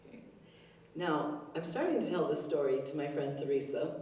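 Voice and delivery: usual speaking voice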